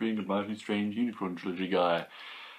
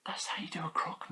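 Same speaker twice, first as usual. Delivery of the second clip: whispered; 7 semitones higher, 8 dB quieter than usual. Real, not acted. Whispered admiration.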